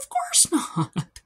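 high, slow, smug put-on voice; smug Bertha. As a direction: draw it out, pleased with herself. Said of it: "And Bertha's like".

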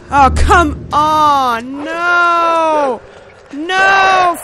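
loud, high yelling; furious shout. Real, not acted. Gamer as his boy gets caught, shouting. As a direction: yell at the screen in real panic.

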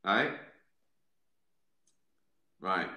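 usual speaking voice

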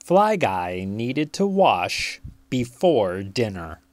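plain reading voice

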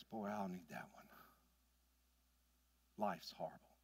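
low, soft, worn-out sigh of a line; weary despair. Retold or imitated. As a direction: sound like life has beaten him. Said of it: "He goes".

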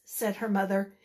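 ordinary delivery

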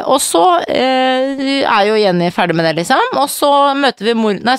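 ordinary talk, nothing marked

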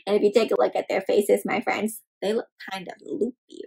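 plain reading voice

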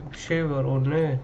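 ordinary talk, nothing marked

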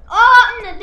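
loud sudden oh no; a sharp, loud cry of worry; alarmed cry. Real, not acted. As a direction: blurt it out in alarm. Danger approaching in game.

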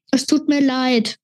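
usual speaking voice

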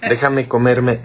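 ordinary delivery

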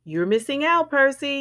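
put-on storybook child's yell, moderately loud; narrated yell. A narrator's reading. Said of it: "yelled Frida".

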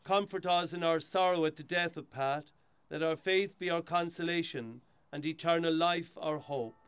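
steady, solemn prayer reading; hushed graveside prayer. Acted. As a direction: lead the mourners in prayer. Priest leads graveside burial rite.

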